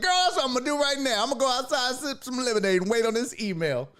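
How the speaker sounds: high, excited retold banter; excited high-voiced reply; gleeful reply. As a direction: pitch it way up, playful and excited.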